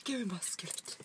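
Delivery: mock-secretive whisper; playful whisper. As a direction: whisper it after all the yelling. Playful whispered version after yelling.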